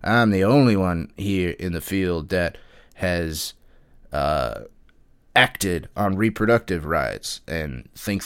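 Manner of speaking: lightly put-on generic American moron accent. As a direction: drag the words out like a clueless American politician. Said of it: "so I'm just gonna do a generic American moron accent".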